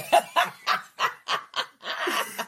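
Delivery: high unvoiced burst of laughter; loud laugh. Real, not acted. Laughing at own story.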